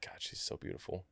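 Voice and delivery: breathless whisper to himself; whispered thought. Retold or imitated. Thinking about Victoria, a starstruck whisper. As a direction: hush it like a secret thought.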